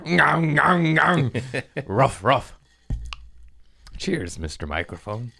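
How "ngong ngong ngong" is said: mock hyper noise. Imitating hyper kid.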